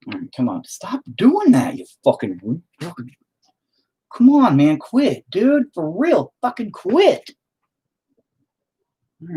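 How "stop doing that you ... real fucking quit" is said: high, loud annoyed yells; exasperated yelling. Real, not acted. Artist yelling at something disrupting his painting. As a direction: snap at whatever keeps interfering.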